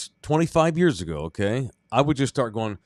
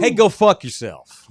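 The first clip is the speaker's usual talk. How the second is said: louder, slower angry curse; 8 semitones higher, 7 dB louder, slower than usual. Pretend. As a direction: tell him off, loud and slow. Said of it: "Let's do a role play".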